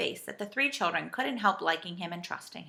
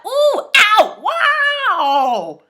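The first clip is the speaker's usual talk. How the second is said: high, loud drawn-out yelps; yelling in pain; 13 semitones higher, 14 dB louder, much slower than usual. A narrator's reading. Saucepan man out of sight above, yelling. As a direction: wail ow at full voice. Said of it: "he began to yell".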